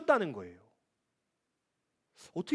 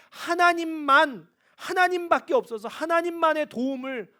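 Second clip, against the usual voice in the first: 하나님만 slow, higher and louder, then 하나님밖에 punched; emphatic stress; 7 semitones higher, 5 dB louder than usual. Shown as a demonstration. Pastor insists to the congregation on God alone, emphatic. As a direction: drive home 하나님만 slowly.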